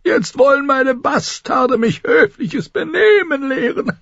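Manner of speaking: put-on high, laughing old man griping; mocking gripe from a high old lord; complaining old lord. A narrator's reading. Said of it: "klagte Lord Walder".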